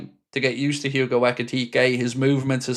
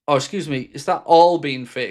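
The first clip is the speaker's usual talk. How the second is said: ordinary delivery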